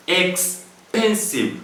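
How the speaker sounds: "expensive" stretched slowly, syllable by syllable; slowed to count syllables. Shown as a demonstration. Teacher showing students the syllables, slow and drawn out. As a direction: draw out "expensive" so each syllable is clear. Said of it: "how many syllables do we have there?".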